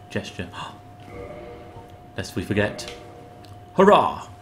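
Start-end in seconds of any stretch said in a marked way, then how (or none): 3.7-4.3 loud, high cheer falling away; high-pitched burst of celebration. Pretend. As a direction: shout a sudden hurrah. Mock cheer during play.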